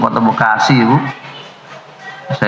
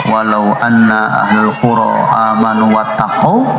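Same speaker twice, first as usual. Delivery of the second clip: Quran recitation; 3 semitones lower than usual. Acted. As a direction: intone it like tilawah, unhurried. Preacher recites Quran verse.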